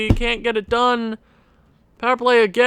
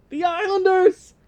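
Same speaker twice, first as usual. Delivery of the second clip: higher, laughing through it; despairing laugh; 10 semitones higher than usual. Real, not acted. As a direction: laugh in disbelief at defeat. Eliminated again.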